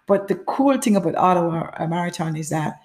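usual speaking voice

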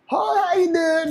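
slow, high-pitched country drawl; hammed-up country greeting, stretched out slowly; country accent. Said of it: "some fucking country motherfucker".

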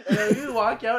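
ordinary talk, nothing marked

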